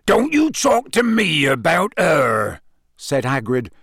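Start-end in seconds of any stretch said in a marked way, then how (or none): 0.1-2.6 slow, high, heated warning; angry character retort; threatening. A narrator's reading. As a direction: warn slowly and forcefully to drop it. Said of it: "he looked quite frightening".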